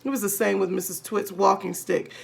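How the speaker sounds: ordinary delivery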